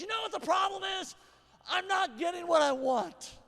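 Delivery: angry dad shouting the phrase in a messy house; reenacted shout. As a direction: yell the phrase out loud at home. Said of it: "use my phrase that I learned".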